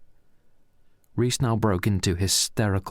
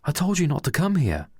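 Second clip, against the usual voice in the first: much higher, angry demand; 9 semitones higher than usual. Acted. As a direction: confront him at the door. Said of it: "said Pedro angrily".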